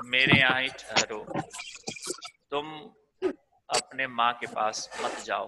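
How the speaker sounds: usual speaking voice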